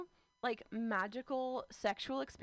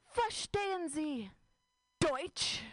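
slow, higher German asking; slow German question; 6 semitones higher, much slower than usual. Acted. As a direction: ask slowly in stiff German. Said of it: "here's the conversation".

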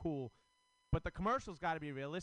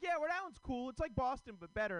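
high, sing-song fake praise; mock agreement; 9 semitones higher than usual. Comic imitating people humoring him, high mock praise. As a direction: agree a little too sweetly. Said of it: "they're like".

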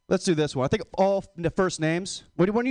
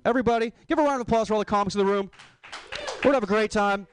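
upbeat host hype, faster at first; mock emcee intro; 3 semitones higher than usual. Comic parodying his own cold open as a club emcee, upbeat host intro. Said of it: "that's gonna be my cold open".

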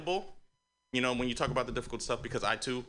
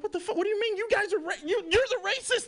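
mock outraged shout; faster than usual. Arguing back, acted quarrel.

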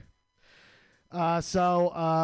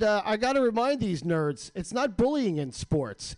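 usual speaking voice

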